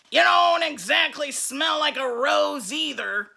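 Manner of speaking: put-on nasty monster boy. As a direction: growl it back at the teacher. Sammy retorts.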